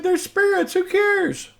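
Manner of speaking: a mocking, disbelieving scoff; incredulous scoff. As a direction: toss it off like it's absurd. Scoffing retold reply to accuser.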